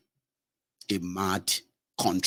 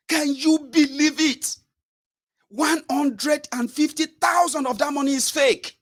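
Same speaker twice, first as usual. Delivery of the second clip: much higher, louder ranting merchant; outraged businessman; 4 dB louder than usual. Retold or imitated. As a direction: rant high-pitched at the reporter. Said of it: "businessman complains".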